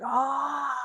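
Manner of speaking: high, held scream without extra volume; long, high-pitched pretend scream; mock yell. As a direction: imitate a family screaming on rapids. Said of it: "Rusty's yelling. They're all like".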